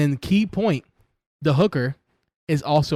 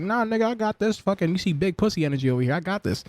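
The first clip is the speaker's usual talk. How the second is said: cocky mock brush-off; 7 semitones higher than usual. Voicing Batgirl's brush-off.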